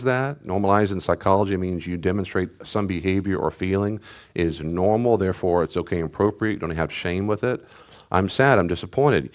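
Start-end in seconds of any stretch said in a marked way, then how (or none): none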